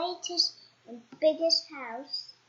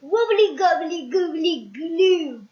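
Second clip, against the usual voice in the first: loud, slow spell chant; magic words; 2 semitones higher, 7 dB louder, slower than usual. Acted. Goblin casting a shrinking spell, loud and slow. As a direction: intone the nonsense spell. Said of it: "magic words which sounded like this".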